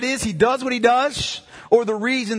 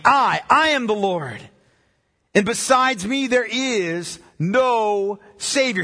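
"I" repeated with weight, "no" held long; emphatic declaration; slower than usual. Acted. Preacher quoting God as only Savior, slow and emphatic. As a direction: declare it slowly, leaning on "I" and "no". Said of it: "He's emphatic in his declaration".

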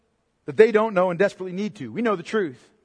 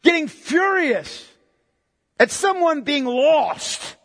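slow, deliberate words; slow emphasis; 6 semitones higher, much slower than usual. Real, not acted. Preacher warning his church against fury, slow and deliberate. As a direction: drag each word out to land it. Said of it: "slow emphatic preaching".

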